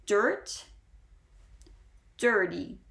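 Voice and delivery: DIRT with a held R, then dirty with a shorter one; clear pronunciation demo. Shown as a demonstration. Teacher saying both words for learners, pronunciation demo. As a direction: hold the R in Dirt, lighter in dirty. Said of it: "I will say both for you again".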